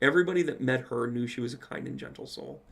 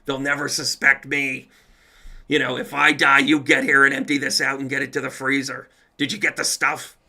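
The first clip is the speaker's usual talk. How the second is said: mock mobster; 8 dB louder than usual. Playing Linda as mobster.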